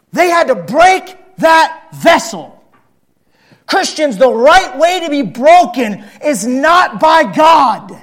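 loud sharp preacher yell. Real, not acted. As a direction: belt it at the congregation. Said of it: "Why are you yelling, preacher?".

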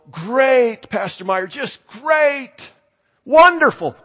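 high, loud, exclaiming church lady; gushing delight. Retold or imitated. Church lady to her pastor over tea, gushing. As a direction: burst out with hammed-up enthusiasm.

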